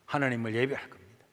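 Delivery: normal talking voice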